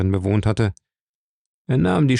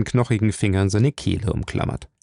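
usual speaking voice

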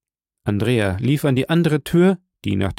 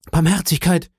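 higher-pitched, mildly panicked plea; frightened cry; 6 semitones higher than usual. A narrator's reading. Cornered fugitive begs two women for mercy, frightened cry. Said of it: "rief er, bleich, verwirrt".

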